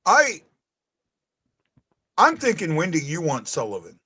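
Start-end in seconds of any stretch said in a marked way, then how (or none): none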